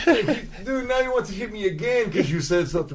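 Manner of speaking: giggly high mock plea; laughing mock girlfriend. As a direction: play her with a laugh.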